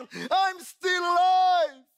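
high, loud, slow triumphant cry; jubilant shout. Acted. The reborn bird shouting to the world, jubilant and drawn-out. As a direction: belt it out like a hymn of victory. Bird sings its joy aloud.

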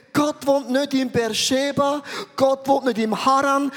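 usual speaking voice

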